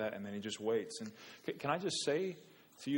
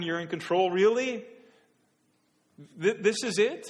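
climbing higher and louder, incredulous, breaking into a laugh; frustrated disbelief; 6 semitones higher, 7 dB louder than usual. Retold or imitated. Believer venting to God: incredulous, louder, with a laugh. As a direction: toss it up at God in exasperated disbelief. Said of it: "in honesty and brokenness and frustration and said".

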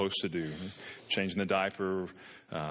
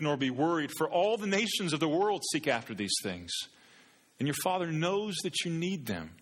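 rising, fervent scripture proclamation, higher; preaching; 4 semitones higher than usual. Acted. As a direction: lift the verses up to the whole church. Preaching the gospel passage.

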